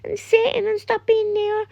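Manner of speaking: very high, thin put-on little voice; squeaky mock-innocent inner voice; imagined frightened child. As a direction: mimic a naive kid's worried thoughts in a high monotone. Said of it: "and they'll be, like, thinking".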